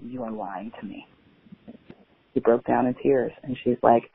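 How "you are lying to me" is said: a quiet, firm accusation to a friend who is lying; quiet confrontation. Retold or imitated. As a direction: say it softly but without doubt.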